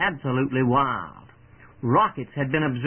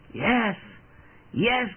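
higher-pitched answer, mildly triumphant; triumphant cry; 6 semitones higher than usual. A narrator's reading. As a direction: call back yes with a touch of triumph. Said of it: "came back the triumphant cry".

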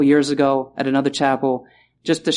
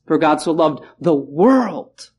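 "world" slow and stressed; slow, emphatic "the world"; slower than usual. Shown as a demonstration. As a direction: drag out "the world" with weight. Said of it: "two words to emphasize".

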